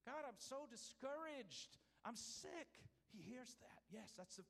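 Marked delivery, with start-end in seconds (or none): 0.1-2.7 higher, mock-anguished plea; battered cry. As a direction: cry out to God, lightly acted. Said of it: "God doesn't just hear the battered cry".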